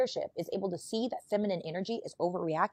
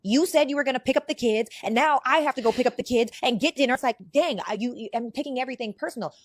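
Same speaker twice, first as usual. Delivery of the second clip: higher and louder griping; mock frustrated complaint; 7 semitones higher, 6 dB louder than usual. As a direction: whine it, worked up. Voicing emotional complaint.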